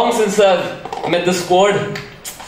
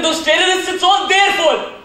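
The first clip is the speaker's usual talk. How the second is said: furious shout; 8 semitones higher than usual. Acted. Hacker turning on the terrorists in a rage. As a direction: spit the blame out.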